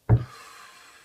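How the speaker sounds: big loud exhale before going on; loud sigh. Real, not acted. Storyteller sighs loudly before recalling the game. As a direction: let out a heavy audible sigh.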